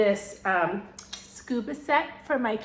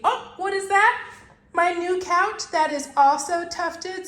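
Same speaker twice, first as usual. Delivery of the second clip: mock surprise; 9 semitones higher, 4 dB louder than usual. Mock surprise at own couch.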